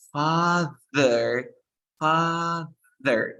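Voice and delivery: "father" split slowly, buzzing long on the th; exaggerated voiced TH. Shown as a demonstration. Teacher models it for Daniela to try, exaggerated voiced TH. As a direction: stretch each half of "father", tongue between the teeth. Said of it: "Ahora dices".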